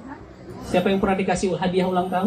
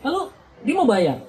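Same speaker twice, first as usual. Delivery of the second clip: ordinary talk, nothing marked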